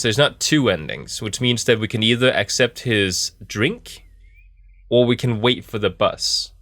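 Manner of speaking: ordinary delivery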